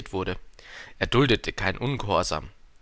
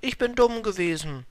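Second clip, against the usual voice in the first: high-pitched flower voice; Blume bittet um Verzeihung; 11 semitones higher than usual. A narrator's reading. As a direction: pitch it well up, a contrite flower. Flower apologises to the prince. (hohe Blumenstimme, reumütig)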